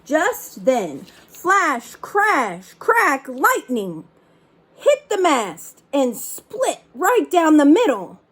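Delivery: punchy dramatic read-aloud; story narration. A narrator's reading. Reader acting out the storm for children, dramatic. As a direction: hit each storm word like a sound effect.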